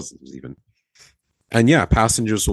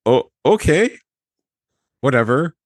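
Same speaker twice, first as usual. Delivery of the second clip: high, sing-song "oh okay, whatever"; mock dismissive; 5 semitones higher, 3 dB louder than usual. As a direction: brush it off with teasing disbelief. Reacting to colleague liking passenger.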